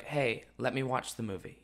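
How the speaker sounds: put-on soft, mumbled kid line; quiet mumble. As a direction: say it under your breath. Quoting his younger self.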